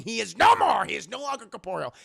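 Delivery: loud mock-grand declaration; emphatic proclamation. Mock-grand announcement of defeat.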